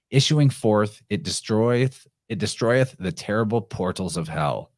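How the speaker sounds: measured, solemn recitation of verse; dramatic reading of a prophetic poem. Acted. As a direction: read it like an ancient prophecy. Reads the Sibyl's poem aloud.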